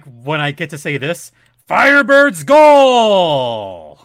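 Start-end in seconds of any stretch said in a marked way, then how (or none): 1.7-4.0 huge stretched arena goal call; booming PA announcer call. Shown as a demonstration. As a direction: boom it out like a hockey PA announcer. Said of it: "Look at that booming voice".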